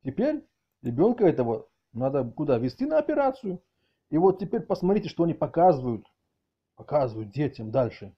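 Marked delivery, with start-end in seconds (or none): none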